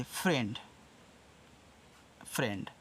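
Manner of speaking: "फ्रेंड" said with a clear fr and soft d; pronounce friend. Shown as a demonstration. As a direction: model "फ्रेंड" for students to copy. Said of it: "अब देखो ऐसे". ("फ्रेंड" का साफ़ उच्चारण)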